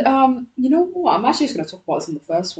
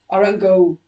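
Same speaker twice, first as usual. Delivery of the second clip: normal talking voice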